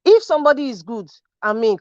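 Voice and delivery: ordinary delivery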